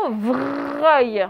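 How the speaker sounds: "vreuil" very slow, with a long R; careful sounding-out. Shown as a demonstration. Teacher warning pupils about the second syllable, slowly stretched "vreuil". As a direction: hold the R in "vreuil" so it stands out.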